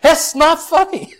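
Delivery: high, loud, slow mock rebuke; a wife snapping at her laughing husband; indignant scold.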